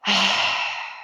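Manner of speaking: long breathy sighing exhale; audible sigh. Shown as a demonstration. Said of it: "let your exhale be an audible sigh".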